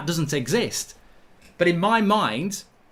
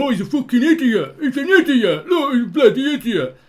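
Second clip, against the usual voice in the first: much higher, louder, laughing mock jeer; mocking jeering critics; 9 semitones higher, 9 dB louder than usual. Mimicking critics who laugh at his Martial take, hammed-up and louder. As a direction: ham up the people laughing at him. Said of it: "you'll get people going".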